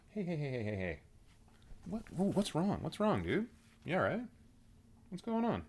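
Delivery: high, soft pet talk. Real, not acted. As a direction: coo at a startled cat. Cat suddenly upset.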